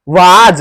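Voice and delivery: loud "वाज" with long aa and final j; wrong pronunciation. Shown as a demonstration. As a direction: punch out the mistaken "वाज", long open vowel. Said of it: "इसको नहीं कहेंगे".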